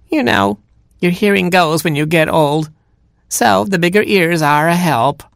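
high, sugary fake granny; cat posing as grandma. Cat reassures Robin about big ears, high mock-granny voice. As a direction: coax the child in a high granny voice.

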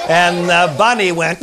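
plain reading voice

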